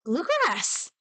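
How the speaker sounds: BLUEGRASS exclaimed high, falling at the end; sudden realization. Pretend. Streamer solving the puzzle, exclaiming Bluegrass in sudden realization. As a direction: cry "Bluegrass" like it finally clicks.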